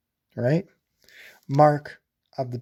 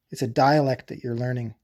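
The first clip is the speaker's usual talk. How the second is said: normal talking voice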